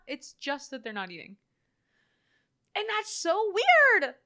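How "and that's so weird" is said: emphatic disbelief. Real, not acted. Stressing how unsettling.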